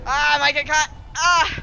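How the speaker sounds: loud panicked yelp; panicked yelling. Real, not acted. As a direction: yell in panic while fleeing. Fleeing in game, panicking.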